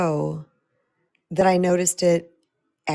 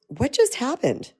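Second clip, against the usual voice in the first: baffled; 7 semitones higher than usual. Retold or imitated. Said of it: "standing in my house going".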